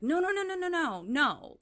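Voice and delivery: high, loud, rushed no's; frantic protest. Retold or imitated. Family hastily stopping Dan from fetching papers, high and loud. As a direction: stop him before he goes, voice shooting up.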